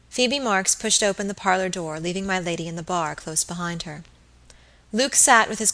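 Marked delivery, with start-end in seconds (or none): none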